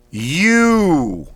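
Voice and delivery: You punched out high and loud in one breath; thrust out sharply. Shown as a demonstration. Coach drilling warm-up, sharp loud thrust-out You. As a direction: dump all the air on You. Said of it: "Thrust out quickly, sharply".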